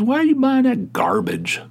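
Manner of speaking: high disbelieving mock wife; wife's shocked high-pitched question; wife scolding. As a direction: squeak it like an appalled wife. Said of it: "She goes".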